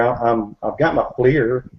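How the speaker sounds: ordinary delivery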